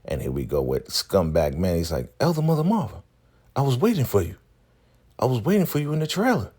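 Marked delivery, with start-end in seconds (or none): 2.2-6.5 high-pitched, putting on innocence; mock earnest claim. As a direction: insist in a much raised voice, hamming it up. Manny feigns an appointment with her.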